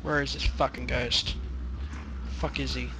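hushed tense cursing; whispering. Real, not acted. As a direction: mutter it low under breath. Hushed cursing while hunting ghost.

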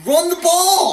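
yelling at the TV. Real, not acted. Fan yells at the coach on TV, furious shout. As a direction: scream the play call at the coach.

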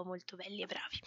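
whispered praise. Real, not acted. Streamer whispering thanks to her moderators. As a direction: breathe it out as a fond aside.

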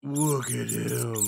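normal talking voice

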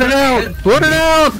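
high urgent yell; shouting. Real, not acted. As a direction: yell it out in a panic. Yelling in a game fight.

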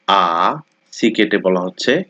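normal talking voice

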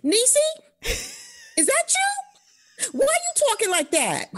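put-on high-pitched, baffled girlfriend on the phone; girlfriend's voice. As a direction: squeal it high, puzzled and amused. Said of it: "This is my girlfriend".

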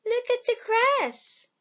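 high, small goat voice; little goat voice. A narrator's reading. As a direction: squeak it like a tiny goat. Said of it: "says the little goat".

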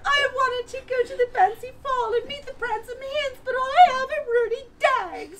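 high and loud with mock crying; hammed-up tearful wail; whiny sobbing girl. Rindersella tells her woes.

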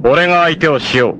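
high, ringing declaration to fight; bold challenge. Acted. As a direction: announce he'll take them on. Stepping up to fight. (挑む宣言)

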